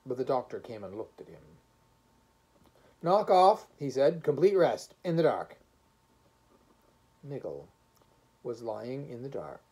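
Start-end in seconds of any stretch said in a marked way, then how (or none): 3.0-5.6 firm order. Acted. Doctor orders complete rest.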